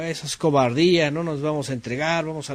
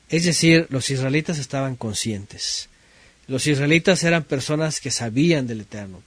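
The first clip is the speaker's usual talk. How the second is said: usual speaking voice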